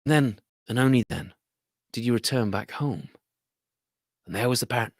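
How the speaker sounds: ordinary delivery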